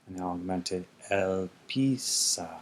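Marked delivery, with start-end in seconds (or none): 1.1-2.5 slow form read. Shown as a demonstration. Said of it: "spells out aorist form".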